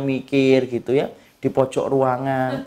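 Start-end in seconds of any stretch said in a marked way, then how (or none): none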